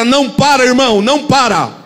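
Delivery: high, loud prophetic proclamation. Pretend. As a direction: proclaim it like a prophet to your neighbour. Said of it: "com voz de profeta".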